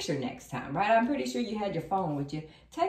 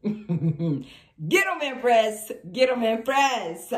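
loud, drawn-out hype; rousing loud cheer; 2 semitones higher, 8 dB louder, much slower than usual. Real, not acted. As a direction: egg her on at full volume. Cheers the Empress on.

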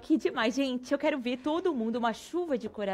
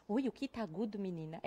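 softer; 5 semitones lower, 10 dB quieter, faster than usual. Real, not acted.

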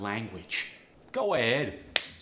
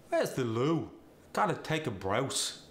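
cockney accent; 2 semitones higher than usual. Shown as a demonstration. Example sentence in Cockney slang.

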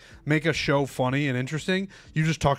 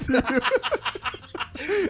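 high burst of laughter; 10 semitones higher than usual. Real, not acted. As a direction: break into a real laugh. Laughing at sleep apnea joke.

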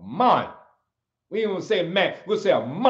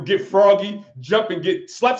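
ordinary talk

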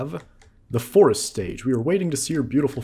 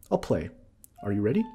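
ordinary delivery

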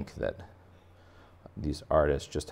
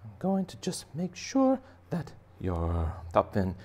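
cleaner fish voice; 5 semitones higher than usual. Acted. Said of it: "this cleaner fish is up here going".

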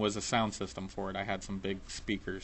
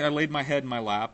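normal talking voice

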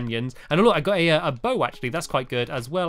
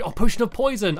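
normal talking voice

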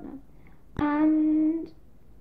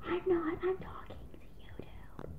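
hushed, breath-only reply; whispered retort; 4 semitones higher, 9 dB quieter than usual. Pretend. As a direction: whisper back to the nosy neighbours. Whispered answer to imagined neighbours.

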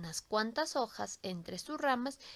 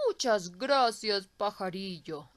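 put-on grateful old tree; 2 semitones lower, 5 dB louder than usual. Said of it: "exclamó el árbol".

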